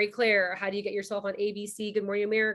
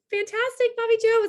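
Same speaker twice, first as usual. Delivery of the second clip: much higher and louder, beaming; excited greeting; 13 semitones higher, 8 dB louder than usual. Real, not acted. Hosting a webinar, cheering a member from Des Moines, excited.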